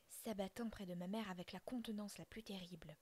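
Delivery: ordinary delivery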